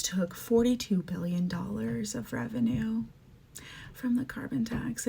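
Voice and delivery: hushed, unvoiced whisper. Real, not acted. Telling viewers about the carbon tax, in a whisper. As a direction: drop to a fierce whisper. Angry rant about fire funding.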